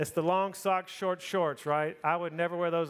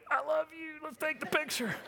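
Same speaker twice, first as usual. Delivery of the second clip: much higher sweet voice for the wife; imitating wife; 11 semitones higher than usual. Wife asking husband for a Disney photo, high mock voice. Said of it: "and she's like".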